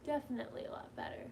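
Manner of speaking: fast, whispered aside to herself; whispering it again quietly; whispered repeat. Real, not acted. Telling viewers she feels better, a quick whisper. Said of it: "It's definitely a lot better".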